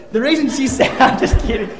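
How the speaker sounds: laughing. Real, not acted. Laughs at his own joke about wife.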